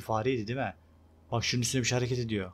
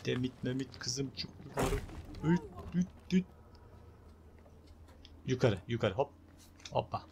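coaxing call; 5 dB quieter than usual. Pretend. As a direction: coax her up with a singsong cluck. Urging Lara to climb.

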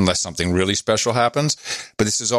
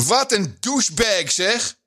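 higher, playful Dutch accent; 10 semitones higher than usual. Shown as a demonstration. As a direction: say it like a Dutchman would. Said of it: "Holland accent?".

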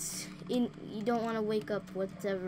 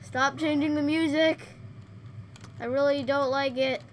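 whiny complaint; 4 semitones higher, 6 dB louder than usual. Real, not acted. Complaining loudly.

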